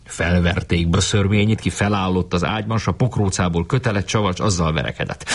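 ordinary delivery

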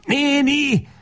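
very high frantic call; frantic cry. Acted. Lajos beside himself calling the woman: very high frantic cry. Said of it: "kiáltja magán kívül".

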